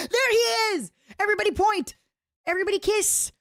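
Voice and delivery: high, loud excited announcing; gleeful shout. Real, not acted. Streamer catching a lurker in chat, high and excited. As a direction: point him out to the whole crowd.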